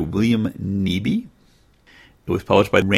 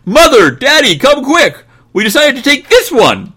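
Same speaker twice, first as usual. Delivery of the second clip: put-on very high, loud child's shout; excited boy shouting; 13 dB louder than usual. Boy calling parents over to see his puppy, high shout. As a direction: holler for your parents. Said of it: "while Jimmy shouted".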